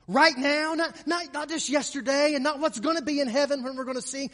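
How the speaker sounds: high, loud, insistent proclamation; impassioned preaching. Real, not acted. Preacher urging that Christ calls us today, impassioned preaching. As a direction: ring out each phrase with fervour.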